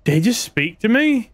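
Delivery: high, alarmed rising questions; spooked by a voice; scared horror game streamer. Real, not acted. Character speaks in horror game.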